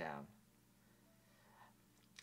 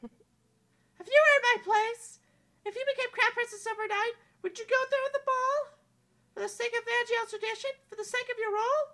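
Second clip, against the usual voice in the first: put-on high, bright young princess, wistful; a lovely princess doubting fairy tales; princess Amelia voice; 14 dB louder than usual.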